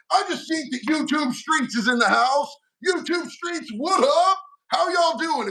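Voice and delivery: hyped, high-pitched welcome to a favourite viewer; excited greeting. Real, not acted. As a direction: call out the greeting like spotting a buddy in the crowd.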